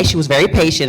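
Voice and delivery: ordinary talk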